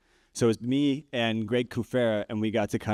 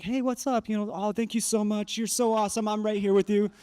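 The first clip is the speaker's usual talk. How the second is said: much higher, bright friendly gush; sudden bubbly welcome; 9 semitones higher than usual. Retold or imitated. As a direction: switch to overly sweet and cheerful. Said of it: "I'm like".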